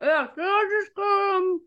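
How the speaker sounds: high, talking around a chocolate; chewing while talking; mouth full. Real, not acted. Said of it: "you can't talk with chocolates in your mouth".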